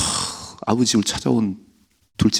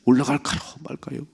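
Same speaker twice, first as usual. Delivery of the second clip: David asking God; 6 semitones higher than usual. Retold or imitated. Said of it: "그렇게 물었던 다윗이요".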